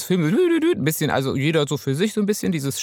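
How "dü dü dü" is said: high sung tuning notes; humming tuning sounds. Retold or imitated. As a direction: hum like instruments tuning. Imitating orchestra tuning.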